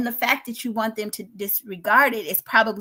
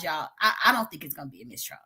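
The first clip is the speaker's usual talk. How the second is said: ordinary delivery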